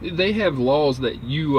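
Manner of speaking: ordinary talk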